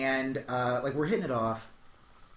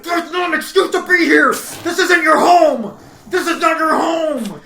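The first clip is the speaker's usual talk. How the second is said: loud, high shouted scolding; upset shout; 14 semitones higher, 16 dB louder than usual. Retold or imitated. Telling the guy it isn't his home, upset shout. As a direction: scold him at full volume. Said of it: "I was, like, so upset".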